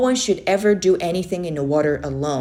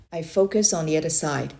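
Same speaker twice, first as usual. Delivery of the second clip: usual speaking voice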